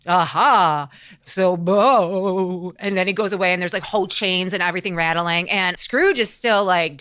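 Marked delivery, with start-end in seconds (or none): 0.1-2.7 drawn-out spooky "ha" and "boo"; hammed-up haunting howl; long mock ghost calls; ghost wails. Ghost wailing noises.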